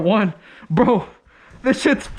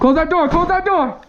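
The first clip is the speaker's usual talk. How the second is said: high frantic repeated order; urgent shout; 6 semitones higher than usual. Real, not acted. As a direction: shout it over and over.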